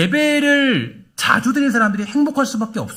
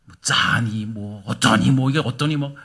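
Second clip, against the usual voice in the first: low mock complaint, each phrase lower; grumbling husband; 8 semitones lower, 4 dB quieter, slower than usual. Husband grumbling at wife's cooking, low mock whine. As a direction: mutter petty complaints about food. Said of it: "좀 짜도 맛있게 먹어도 되잖아요".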